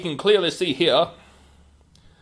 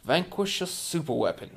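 ordinary talk, nothing marked

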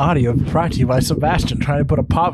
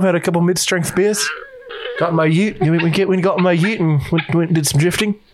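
light mock Kiwi bloke accent, a touch higher; New Zealand accent; 3 semitones higher than usual. Kiwi mate describes Andy's breakup, mock New Zealand accent. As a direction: play the bloke telling mates about poor Andy, light Kiwi accent. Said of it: "They chirp in New Zealand accent".

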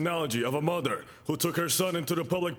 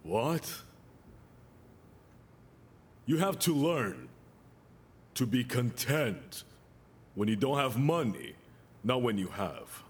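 slow, soft preaching; 9 semitones lower, 3 dB quieter, slower than usual. Acted. Slow soft sermon teaching.

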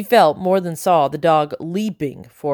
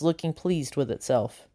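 ordinary delivery

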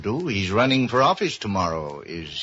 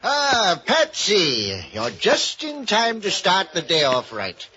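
grand greeting; 8 semitones higher, slower than usual. Acted. Tipsy ex-doctor hailing Novak in the wine bar, hammy welcome. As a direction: boom out a hammy welcome to an old pal.

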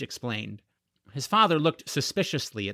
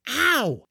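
sharp high yelp, pitched way up; a short pained yelp; 11 semitones higher than usual. A narrator's reading. Boy hit by crashing dragon, sharp yelp. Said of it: "George yelped".

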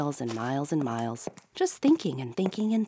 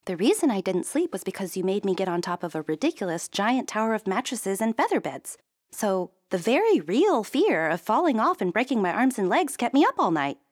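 higher, louder, rising complaint; alarmed princess; 6 semitones higher, 5 dB louder than usual. A narrator's reading. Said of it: "Princess looked alarmed".

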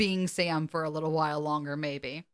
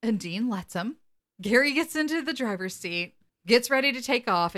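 ordinary talk, nothing marked

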